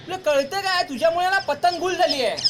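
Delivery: exasperated big brother chewing him out; angry rebuke. Acted. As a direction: scold him for losing the kite. Brother blames kid for lost kite.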